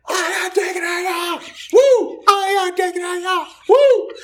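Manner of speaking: very high, squealed chant with whoops; high-pitched mock kid yell. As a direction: imitate a small child chanting at a shrill pitch. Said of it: "comes running down the hall yelling".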